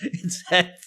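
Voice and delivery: can barely get it out for giggling; amused retort broken up by laughter; laughing. Retold or imitated. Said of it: "And I start to laugh".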